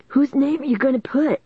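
high-pitched, insistent question; demanding. A narrator's reading. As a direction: demand an answer. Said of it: "Lanny demanded".

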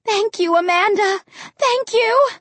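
high-pitched dying call of thanks; high, strained, fervent gratitude; grateful cry. A narrator's reading. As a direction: cry out thanks with the last strength. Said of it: "opened her toothless mouth and cried".

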